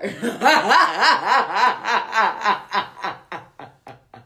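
bursting out laughing; big loud laugh. Real, not acted. Big laugh after story.

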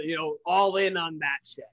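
usual speaking voice

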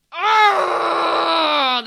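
high, loud creature roar; creature yell. Acted. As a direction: bellow in creature rage. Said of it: "the creature yelled".

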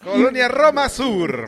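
high, loud, slow mock announcement. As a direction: belt out the address like an ad.

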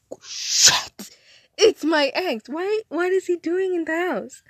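loud breathy curse rising into high-pitched alarm; dramatic, retold panicked disbelief; shocked outburst. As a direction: react aghast, voice jumping up.

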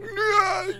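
high, sudden cry of disbelief; joyful cry. Retold or imitated. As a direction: yelp it out as the goal goes in. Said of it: "I'm like".

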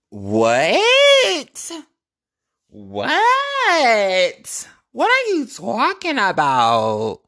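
high, loud, stretched what; outraged shriek of disbelief; shocked disbelief. Retold or imitated. Shocked at rude reply.

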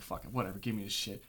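low, soft dismissal; retold grudging surrender. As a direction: give in under your breath. Retells giving in to breathalyzer.

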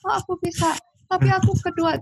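high, lightly tearful quote; retold tearful voice. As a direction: imitate a crying athlete, pitched high. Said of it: "Wah, dia nangis kan".

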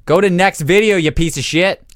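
ordinary talk, nothing marked

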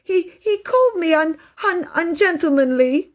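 put-on high-pitched, stammering child's sob; sobbing. A narrator's reading. Phyllis telling Bobby what Peter called her, sobbing. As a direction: wail it, catching on the word. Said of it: "sobbed Phyllis".

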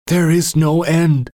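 high, loud, slow cry through broken teeth and bleeding lips. Acted. Beaten man defying his tormentor, slow loud cry. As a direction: force the words out past a battered mouth. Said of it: "with broken teeth and bleeding lips".

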